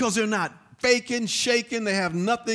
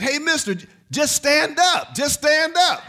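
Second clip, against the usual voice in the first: put-on higher, louder call of a young boy across the water; a kid yelling to a man in the water, higher and louder; 9 semitones higher, 7 dB louder than usual. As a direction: shout it to him like a kid who sees the water is shallow. Boy calling to drowning man.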